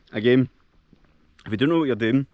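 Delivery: talking with a mouth full of berries; words round a mouthful of fruit. Real, not acted. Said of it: "shouldn't speak with my mouth full".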